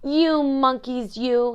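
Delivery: loud, high, drawn-out scolding; angry peddler. A narrator's reading. Peddler scolding monkeys who took his caps, angry and loud. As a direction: scold them with a storybook temper. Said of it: "shaking his fist at them".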